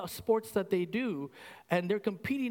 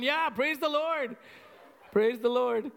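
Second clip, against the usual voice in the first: mock praising wife; 5 semitones higher, 5 dB louder than usual. Jane praising the Lord for her husband, high and hammed-up. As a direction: ham up a gushing church lady. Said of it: "She's going".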